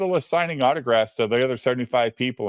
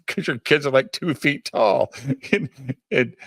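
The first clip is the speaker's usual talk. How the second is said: laughing while talking; 3 semitones higher than usual. Real, not acted. Storyteller quoting Dale's camera advice, laughing through it. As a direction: laugh breaking into the quoted quip.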